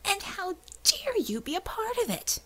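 indignant hissed whisper. A narrator's reading. Angry woman accusing John of complicity, hissed whisper. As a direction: spit the accusation in a hiss. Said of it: "Belladonna hissed at him".

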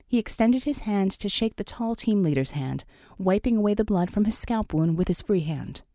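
ordinary delivery, nothing marked

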